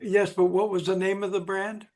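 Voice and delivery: normal talking voice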